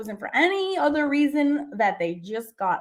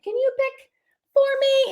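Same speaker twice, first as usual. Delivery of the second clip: asking way up high; a high sweet request; mock sweet high ask; 11 semitones higher than usual. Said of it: "I was like".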